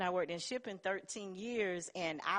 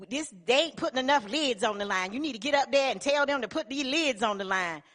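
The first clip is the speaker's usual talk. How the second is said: bossy order; 5 semitones higher, 5 dB louder, faster than usual. Retold or imitated. Boss ordering over radio.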